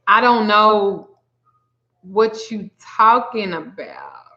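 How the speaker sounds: slow, drawn-out denial; emphatic denial. Retold or imitated. Reader tells an accusing client she's clueless, slow and deliberate. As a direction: stretch each phrase with pauses between.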